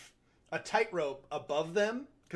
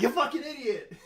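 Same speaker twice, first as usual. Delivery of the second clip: laughing exasperation; 8 semitones higher than usual. Retold or imitated. Viewer's reaction to the hag.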